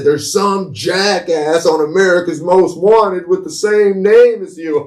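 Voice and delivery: high, slow mocking kid voice; milk it slowly and high, like a kid teasing; teasing friend. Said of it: "He said".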